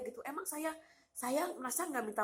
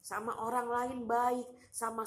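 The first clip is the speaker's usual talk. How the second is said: usual speaking voice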